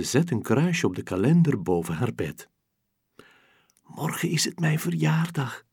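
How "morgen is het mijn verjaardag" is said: ordinary delivery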